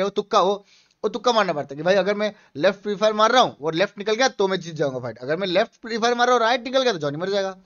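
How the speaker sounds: ordinary talk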